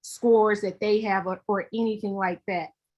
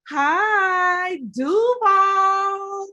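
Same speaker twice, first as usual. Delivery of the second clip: high, loud, drawn-out hello; big sing-out greeting, pitched up; excited shout-out; 9 semitones higher, 5 dB louder, much slower than usual. Real, not acted. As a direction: call out to fans joyfully. Calling out greeting to viewers.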